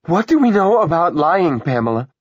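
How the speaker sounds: high, loud scolding; angry yell. A narrator's reading. Georgia scolding her daughter for lying, yelling. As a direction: yell at the child, dropping on the name. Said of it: "Georgia Andreessen yelled".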